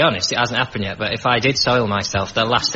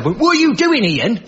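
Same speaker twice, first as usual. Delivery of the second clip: high, louder mock Brummie dad; imitated Birmingham dad; 11 semitones higher, 4 dB louder than usual. Comic imitating a Brummie dad scolding his son, mock Brummie accent. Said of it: "They were from Birmingham".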